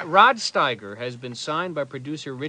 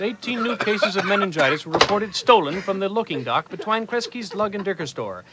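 deadpan newsreader delivery; mock news read; 4 semitones higher than usual. Newsman reading an absurd item, deadpan news voice. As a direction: read it straight like a bulletin.

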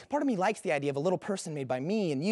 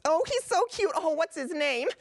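very high, squealing puppy adoration; gushing; 9 semitones higher than usual. Acted.

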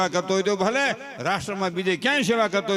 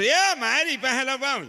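fussing grandmother; 7 semitones higher, 4 dB louder, slower than usual. Retold or imitated. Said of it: "તા તો grand mother".

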